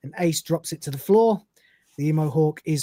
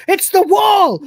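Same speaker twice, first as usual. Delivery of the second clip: very high, loud frantic yell; a jittery robot losing his nerve; panicked shout; 14 semitones higher, 8 dB louder, slower than usual. Acted. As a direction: shriek it as the panic takes over. Crichton panics, blasts wall.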